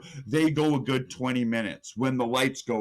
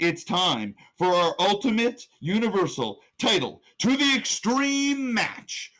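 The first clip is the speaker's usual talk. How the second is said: big announcer build, stretched higher at the end; ring announcer; 6 semitones higher than usual. Acted. Announcing the Ultimate Universal title match, ring-announcer style.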